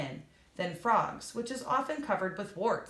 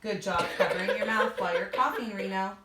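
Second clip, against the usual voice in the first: quick, laughing praise; faster than usual. Real, not acted. Praising Reno for covering his cough, laughing. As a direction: chuckle while you praise.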